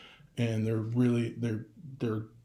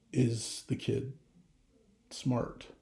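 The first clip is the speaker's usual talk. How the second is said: hushed quote; 5 dB quieter, much slower than usual. Retold or imitated. Quoting Forrest Gump's worried question.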